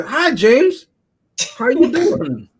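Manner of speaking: high, eager sing-out greeting; happy greeting. Acted. Host mimics a guy so happy to be there, gleeful greeting.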